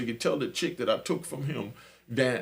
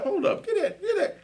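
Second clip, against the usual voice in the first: put-on laughing girlfriend. Girlfriend laughing, handing off phone.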